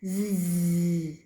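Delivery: long low buzz on "Z"; voiced Z. Shown as a demonstration. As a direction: hold "Z" so the throat vibrates. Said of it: "Z has voice".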